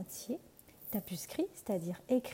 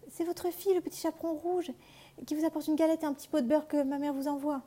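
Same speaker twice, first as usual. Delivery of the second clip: put-on high little girl's voice; 6 semitones higher than usual. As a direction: answer sweetly as the child. Fillette répond au loup. (voix aiguë de petite fille)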